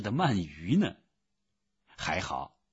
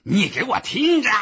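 loud, high-pitched angry demand; enraged yell at full pitch; furious shout; 10 dB louder than usual. A narrator's reading. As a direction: explode at the clerk. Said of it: "波普先生气坏了".

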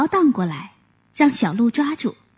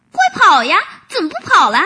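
higher, louder urging shout; loud calling; 6 semitones higher, 6 dB louder, much faster than usual. Acted. Rabbit shouting at the deer to keep running. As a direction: yell to hurry the deer on. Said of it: "小兔子叫着".

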